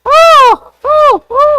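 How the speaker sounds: high excited squealing; excited squeals. Real, not acted. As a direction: squeal with delight. Excited reaction to donkey.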